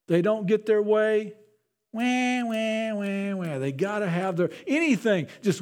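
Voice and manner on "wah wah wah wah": imitated whiny baby crying, fading off; mock baby wail. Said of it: "we know what babies do".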